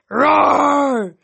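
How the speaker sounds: high, loud, drawn-out roar; roaring cheer; Cyclops roar. Acted. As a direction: let out a long crowd roar. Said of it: "the Cyclops roared".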